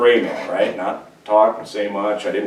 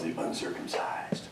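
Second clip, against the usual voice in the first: whispered insult; 6 semitones lower, 15 dB quieter than usual. Acted. Preacher whispers the Judaizers' insult, hushed. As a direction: whisper the slur, much softer. Names the insult under breath.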